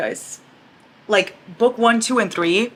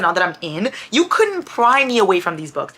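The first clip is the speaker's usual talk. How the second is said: ordinary talk, nothing marked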